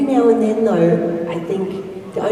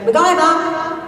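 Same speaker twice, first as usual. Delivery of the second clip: calling the dog; 11 semitones higher, 4 dB louder, much slower than usual. Retold or imitated. Owner calling the dog by name. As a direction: call the dog by name, high. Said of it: "if we go".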